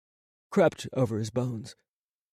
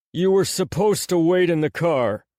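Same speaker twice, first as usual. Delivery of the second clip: loud, higher rebuke; angry scolding; 6 semitones higher, 5 dB louder than usual. Acted. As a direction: scold him hard for not waiting. Barnes scolds Pasha.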